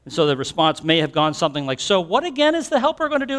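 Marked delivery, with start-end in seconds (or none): 1.8-3.4 high, bewildered question; confused question. Retold or imitated. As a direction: ask like you lost the thread. Confused disciples ask again.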